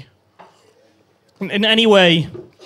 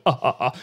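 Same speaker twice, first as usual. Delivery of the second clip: mock manly chuckle; 8 semitones lower, 5 dB quieter, faster than usual. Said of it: "the hearty, manly chuckle".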